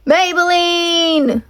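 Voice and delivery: long, high, drawn-out shout. Acted. Leroy calling his horse in the rain, drawn-out shout. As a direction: call her name slowly across the dark. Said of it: "Leroy shouted".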